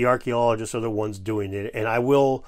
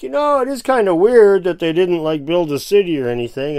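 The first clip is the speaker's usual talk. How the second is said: mock imitation; 4 semitones higher, 6 dB louder than usual. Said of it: "he's like".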